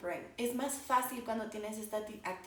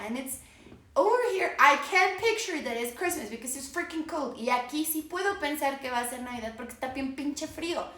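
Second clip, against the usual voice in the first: ordinary delivery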